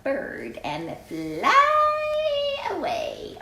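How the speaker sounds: put-on high, slow and dreamy, like a child bunny; little bunny voice. Bunny tells his mother he'll fly away, high and slow. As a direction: float the words up like a child wanting to fly.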